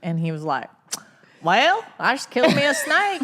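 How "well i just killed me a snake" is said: high, loud Southern drawl imitating the uncle; uncle's Southern drawl. Telling her niece about a fresh kill in a Southern drawl. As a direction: put on a thick country drawl. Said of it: "that's where my Southern drawl comes from".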